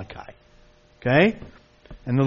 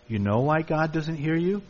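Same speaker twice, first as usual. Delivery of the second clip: normal talking voice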